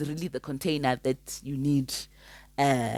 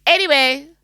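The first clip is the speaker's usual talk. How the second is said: bright anyway; 10 semitones higher, 8 dB louder than usual. Real, not acted. Moving on.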